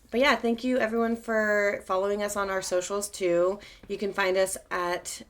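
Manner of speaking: usual speaking voice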